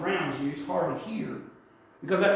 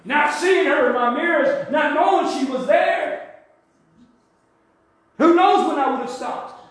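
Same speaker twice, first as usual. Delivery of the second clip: higher, louder preaching cry; fervent preaching; 6 semitones higher, 3 dB louder than usual. Real, not acted. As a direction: stir the congregation, voice raised. Preaching at force.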